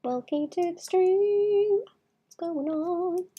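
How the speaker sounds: high, slow sing-song greeting. Acted. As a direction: croon a welcome, stretched out. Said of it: "you consistently get the sing-song voice".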